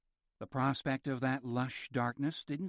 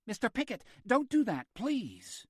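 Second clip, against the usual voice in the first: high, louder urgent plea; alarmed nurse; 10 semitones higher, 4 dB louder than usual. A narrator's reading. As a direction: stop him, alarmed. Nurse stopping patient, alarmed.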